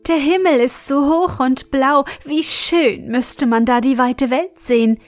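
put-on eager, wistful young heroine; eager girl Akelei. Akelei voices her wish to fly.

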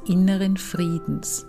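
calm, unhurried meditation voice with long pauses; guided meditation. Acted. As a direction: ease the listener into relaxation. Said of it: "Weiter geht es nun mit einer Meditation".

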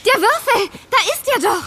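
high, loud excited cry; excited discovery. Acted. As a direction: shout out the find. Spotting the dice.

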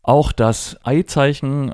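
ordinary delivery

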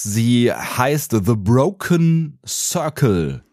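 slow, festive announcer opening. Acted. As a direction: open the show grandly and slowly. Formal podcast opening.

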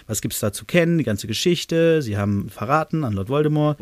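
ordinary delivery, nothing marked

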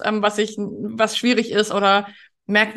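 normal talking voice

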